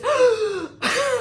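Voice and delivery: sharp high shocked gasp; loud gasp. Retold or imitated. Receptionist spins around to the bride, loud shocked gasp. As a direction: gasp at the sight. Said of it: "She gasped".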